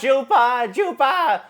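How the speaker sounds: high, laughing silly call; goofy oddball clone; high giggly Gregor voice. Shown as a demonstration. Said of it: "you got your Gregors".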